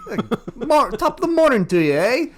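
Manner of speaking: high, loud, fast mock Irish brogue; mock Irish accent. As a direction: ham up a cheesy Irish greeting. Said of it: "I don't even know if that was a right accent".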